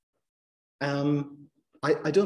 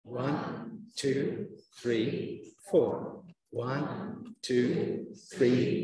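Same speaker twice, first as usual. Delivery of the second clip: soft counting; 3 semitones lower, 5 dB quieter, much slower than usual. Shown as a demonstration. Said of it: "Go on".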